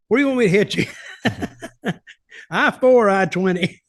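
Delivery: laughing through a mock question to the opponent; mock question to Carlos in the corner, laughing; laughing tease. Ribbing a wrestler about his scarred head, laughing.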